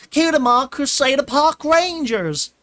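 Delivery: high, loud, rallying yell; filly yelling. Retold or imitated. Filly retelling Scootaloo's yell to Twilight, loud. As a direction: shout it like a club battle cry. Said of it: "Scootaloo yells".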